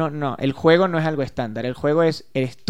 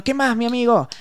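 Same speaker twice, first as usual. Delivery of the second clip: casual friendly greeting; 8 semitones higher than usual. Shown as a demonstration. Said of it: "Yo conecto con los niños".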